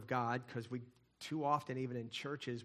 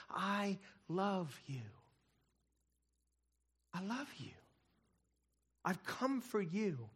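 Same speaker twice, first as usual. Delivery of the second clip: slow, tender "I love you"; gentle whisper; 6 semitones higher, slower than usual. Acted. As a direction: say it like God whispering it. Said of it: "God whispering to us".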